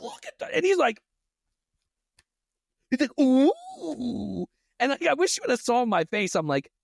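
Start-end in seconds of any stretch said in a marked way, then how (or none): 0.0-0.5 very high, soft awed squeak; awed cashier. Retold or imitated. Cashier voiced in story. 3.2-4.5 high cooing ooh; awed coo. Retold or imitated. Cashier voiced in story.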